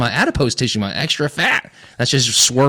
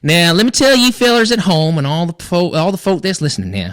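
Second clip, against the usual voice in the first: hammed-up Western cowboy accent, pitched up at the start; put-on country cowboy accent; 4 semitones higher than usual. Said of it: "I love that you're doing an accent".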